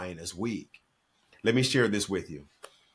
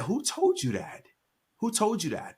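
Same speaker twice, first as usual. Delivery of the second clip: incredulous father; 12 semitones higher than usual. Retold or imitated. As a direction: retell astonished protest, pitch way up. Father protesting to son, retold.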